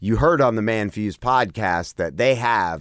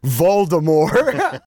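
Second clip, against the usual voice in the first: mock moan; 4 semitones higher, 3 dB louder, much slower than usual. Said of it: "as you were moaning".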